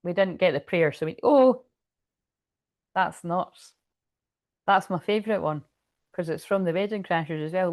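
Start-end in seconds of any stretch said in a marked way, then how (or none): none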